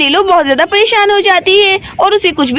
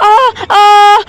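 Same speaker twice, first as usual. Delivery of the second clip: two loud acted cries of pain; 2 semitones higher, 8 dB louder than usual. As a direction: cry out as if landing hard from a fall. Falls from cot, hurts head. (गिरने पर दर्द भरी आह)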